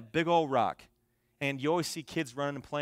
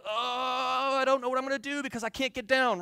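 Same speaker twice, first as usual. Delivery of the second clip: mock scared whimpering; 10 semitones higher, 4 dB louder than usual.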